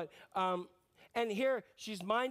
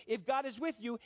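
usual speaking voice

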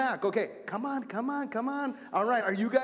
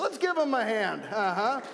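ordinary talk, nothing marked

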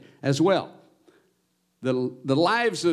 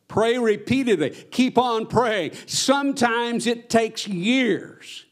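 fervent preaching; 8 semitones higher, slower than usual. Acted. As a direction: urge them to keep praying.

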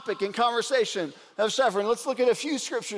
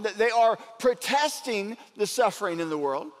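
usual speaking voice

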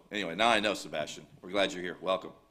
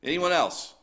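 louder and higher, calling across the church; raised voice inviting another speaker; 10 semitones higher, 10 dB louder, slower than usual. Real, not acted. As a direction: call out to the whole room for the next testimony. Pastor calling out to congregation.

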